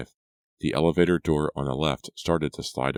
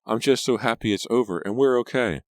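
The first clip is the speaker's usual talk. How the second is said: 1 happy relief; 8 semitones higher, 3 dB louder than usual. A narrator's reading. Tearful relieved cousin.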